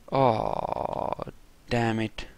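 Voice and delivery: usual speaking voice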